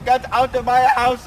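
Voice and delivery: angry. Acted. Repeated angry order.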